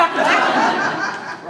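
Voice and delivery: high loud burst of laughter. Real, not acted. Speaker laughing at the crowd's roar, loud laughter. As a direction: let out a big laugh.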